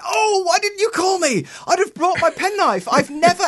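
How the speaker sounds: sending up her own eagerness in a squeaky mock voice; mock high-pitched self. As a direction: play the eager penknife owner, way up high. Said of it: "And I said".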